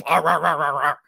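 loud, high mock grumble of frustration; grumbling rant noise. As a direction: sputter out angry nonsense syllables. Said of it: "You sound like a Canadian".

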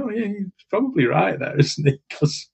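normal talking voice

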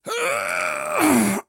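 rough little throat clear, fading out. A narrator's reading. Dying man clears throat again before his story. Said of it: "But then he cleared his throat".

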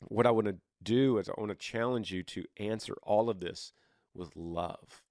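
normal talking voice

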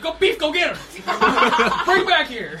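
yelling. Acted. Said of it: "I yell at Beef".

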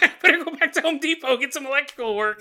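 fussy homeowner. Retold or imitated. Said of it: "You're like".

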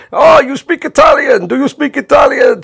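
put-on high, loud Italian-accented teasing; shouting Italian host. Host an inch from Bob's face, loud teasing shout. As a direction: bark the question into his face in an Italian accent. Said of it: "kept going up to him and said".

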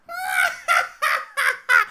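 shrieking high devilish cackle; evil cackle. Acted. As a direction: cackle like a wicked imp. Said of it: "This fucking voice of evil".

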